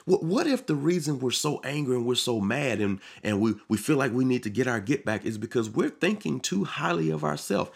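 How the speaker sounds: usual speaking voice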